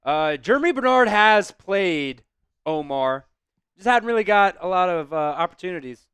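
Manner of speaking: ordinary delivery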